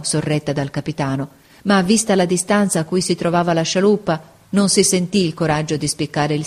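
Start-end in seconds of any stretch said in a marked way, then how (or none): none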